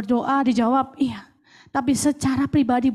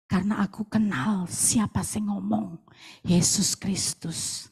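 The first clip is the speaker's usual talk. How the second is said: quiet confession; 8 semitones lower, 4 dB quieter, slower than usual. Retold or imitated. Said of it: "saudara pasti berkata".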